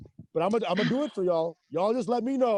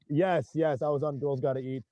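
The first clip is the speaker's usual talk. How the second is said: ordinary talk, nothing marked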